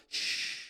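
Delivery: soft drawn-out shushing; long hush. Acted. Preacher correcting people seeking God in disasters, long shush. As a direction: hush the noise gently. Hushing those seeking loud signs.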